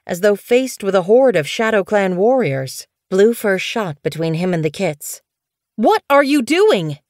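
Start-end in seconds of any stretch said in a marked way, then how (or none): 5.8-7.0 high, loud, slowed shocked outburst; bursting out. A narrator's reading. Blue Fur confronts the medicine cat, loud and alarmed. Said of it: "she burst out".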